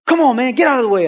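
loud, high mock road-rage yell. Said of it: "road rage moment".

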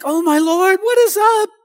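high, loud tearful plea; mock crying. Pastor acting out asking the Lord why he cries, mock wail. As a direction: wail it in hammed-up bewilderment. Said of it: "I'm crying and I can't stop".

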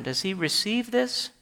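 normal talking voice

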